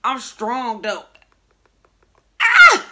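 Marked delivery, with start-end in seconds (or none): 2.4-2.9 high strained shout of effort; straining yell. Real, not acted. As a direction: yell while pulling hard. Straining to pull books apart.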